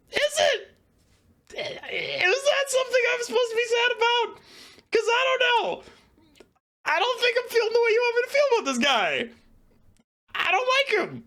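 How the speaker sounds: high, sarcastic disbelief with an edge of a cry; mock incredulous. Reviewer mocks the book's grief for Cahir, high incredulous voice. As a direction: sneer back at the book as if it were absurd.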